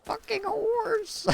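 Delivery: very high, drawn-out squealed quote; mock high scream. Streamer quoting a meme after joking about marrying a horse, mock scream. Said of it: "he's screaming".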